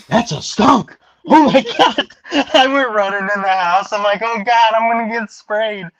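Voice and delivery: loud, high hammed-up alarm; imitated frantic shout, pitched high; retold panic. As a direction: yell it in mock panic. Retells panic at skunk.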